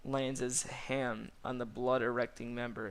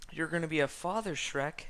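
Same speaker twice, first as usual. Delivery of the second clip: quick, higher, lightly voiced donkey; excited announcement; 5 semitones higher, 3 dB louder than usual. A narrator's reading. Donkit telling Shrek of fatherhood, quick and higher. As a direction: rush out the big news.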